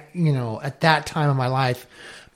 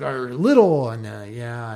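normal talking voice

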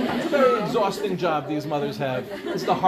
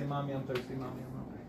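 put-on much softer, as if echoing a longed-for child; wistful hush; 5 semitones lower, 12 dB quieter, faster than usual. Childless woman at the park wishing for a child, barely audible. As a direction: breathe the words like a private longing.

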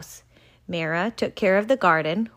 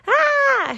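high startled shriek; a sudden yelp of shock; a girl's startled shriek; 5 dB louder than usual. Acted. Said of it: "shrieked Mira".

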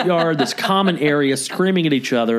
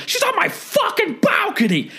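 even higher, over-the-top screech; screaming neighbor. Retold or imitated. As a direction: push the outrage to a shriek. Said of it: "Just screaming".

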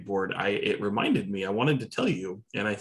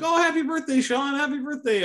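high, excited birthday well-wishers, imitated; high-pitched mock congratulations; mock crowd cheer; 4 dB louder than usual. Retelling crowd's birthday commotion.

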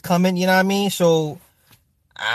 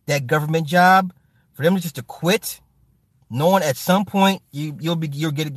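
ordinary delivery

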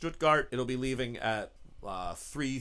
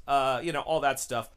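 ordinary talk, nothing marked

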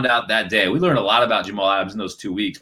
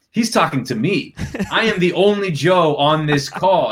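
higher, deliberate emphasis on each word; dawning realization; 6 semitones higher than usual. Retold or imitated. Reporter realizing he is being singled out, dawning realization. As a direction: let the mock dread sink in. Retells his dawning dread on call.

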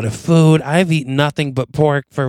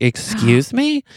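offended surprise; 10 semitones higher, slower than usual. Retold or imitated. Offended by "you people.

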